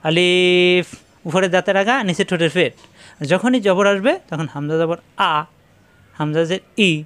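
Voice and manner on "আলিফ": আলিফ drawn out on the ই sound; elongated vowel. Shown as a demonstration. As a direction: hold the second syllable of আলিফ long and level. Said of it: "অর্থাৎ লম্বা করা যায়". (লম্বা করে টেনে আলিফ)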